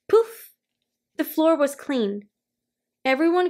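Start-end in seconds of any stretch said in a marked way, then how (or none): 0.1-0.6 magic poof. A narrator's reading. Magic spell takes effect.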